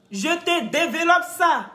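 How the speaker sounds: "ça" drawn out slowly with a falling end; slow deliberate repeat. Shown as a demonstration. Boasting to the viewers that she explains everything: slow, deliberate. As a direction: spell out "Je te développe ça" slowly and firmly. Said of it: "repeated slowly".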